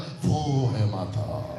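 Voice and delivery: very slow, low "वो है माता" trailing long; drawn-out low reading. Shown as a demonstration. Preacher mimicking what the writer means, low and dragged. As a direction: drag out every word, down low. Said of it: "ये क्या बोल रहा है".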